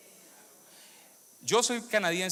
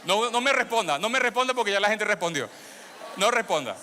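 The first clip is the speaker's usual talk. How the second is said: loud, high, grinning "don't answer"; playful loud tease; 6 semitones higher, 7 dB louder than usual. Real, not acted. Preacher teasing a congregant, loud playful call. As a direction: call it out over the laughter, teasing.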